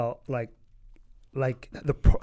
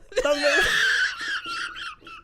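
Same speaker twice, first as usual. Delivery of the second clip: very high howling laughter; shrieking laugh. Real, not acted. Cracking up.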